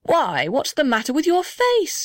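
high, swooping storybook astonishment; acted high exclamation; astonished cry. A narrator's reading. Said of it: "he cried".

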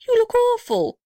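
very high-pitched mock horror; hammed-up high exclamation; astonished cry. A narrator's reading. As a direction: squeal it in storybook dismay. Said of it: "he cried".

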